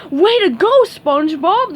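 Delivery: loud, high cheering interruption; excited cheer. A narrator's reading. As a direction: cut in with a hearty cheer. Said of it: "the starfish interrupted".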